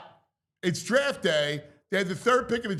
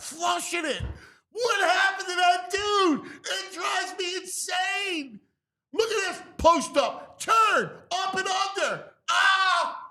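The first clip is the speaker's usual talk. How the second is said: excited; 9 semitones higher than usual. Real, not acted. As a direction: rave like a stunned fan. Raving over highlight reel.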